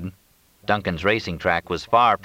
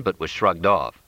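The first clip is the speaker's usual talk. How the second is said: ordinary delivery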